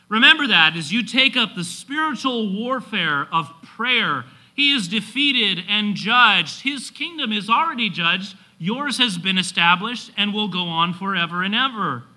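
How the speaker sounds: building, triumphant sermon delivery; preaching at full force. Real, not acted.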